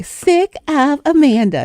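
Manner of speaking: put-on high, slow baby girl voice; little baby girl voice. Niece finishing grandmother's joke, high slow baby girl voice. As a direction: say it like a tiny girl copying grandma. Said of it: "in her little baby girl voice".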